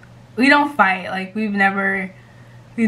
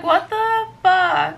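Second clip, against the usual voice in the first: slow, higher-pitched mock disbelief; imitating friend's bewilderment; 8 semitones higher, slower than usual. Reading out best friend's reply to the prank, drawn-out disbelief. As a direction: stretch it out high as the baffled friend. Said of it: "She's like".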